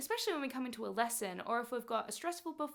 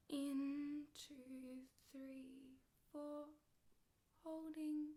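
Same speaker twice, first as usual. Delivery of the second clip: breathing count; 2 semitones higher, 12 dB quieter, much slower than usual. Shown as a demonstration. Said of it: "Nice and slow and controlled".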